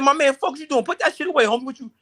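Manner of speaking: high, loud street-tough warning; tough street kid. Retold or imitated. Young boy warning off the older teen, high tough voice. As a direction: warn him off like a hardened kid. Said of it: "I said".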